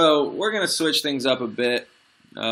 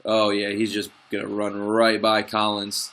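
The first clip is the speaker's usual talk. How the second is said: normal talking voice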